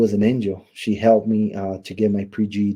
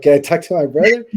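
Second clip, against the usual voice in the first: higher, louder imitated protest; retold indignant request; 6 semitones higher, 6 dB louder than usual. Inmate asking brother's wife for his brother, indignant. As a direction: push past her with a raised voice. Asks past the confronting wife.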